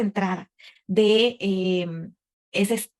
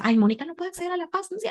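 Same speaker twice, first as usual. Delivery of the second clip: mock whiny self-quote; 9 semitones higher, faster than usual. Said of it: "Simplemente por eso".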